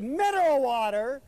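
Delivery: high, loud disbelieving "Mineral water?"; incredulous echo. Retold or imitated. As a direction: toss her words back in disbelief. He echoes her in disbelief.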